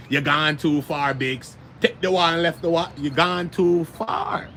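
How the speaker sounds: slow Jamaican movie voice; Jamaican accent. Retold or imitated. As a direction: drawl it like a Jamaican movie gangster. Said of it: "Shata's movie, the Jamaican shit".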